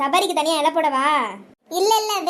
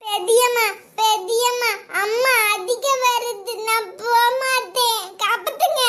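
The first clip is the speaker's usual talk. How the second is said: put-on high, slow pleading kid, half sung; frightened child; 5 semitones higher, slower than usual. Child begging aunt for rescue from mother, high and pleading. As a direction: beg auntie to save you.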